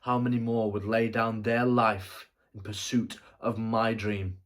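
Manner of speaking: loud, swelling theatrical demand; impassioned rhetorical question. Acted. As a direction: cry it out to the heavens. Anguished rhetorical question, building.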